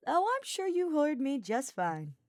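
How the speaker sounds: smirking. Acted. Sylvain calling Hubert's bluff, smirking. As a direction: say it with a knowing smirk. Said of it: "Sylvain smirked".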